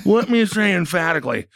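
mock feeble emphasis; Hoyer. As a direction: imitate a politician straining to sound emphatic. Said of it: "sound like cigars trying to fart".

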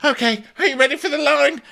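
very high, fast squeak; cheerfully clueless; mock chirpy Courtney. Mocking Courtney throwing line.

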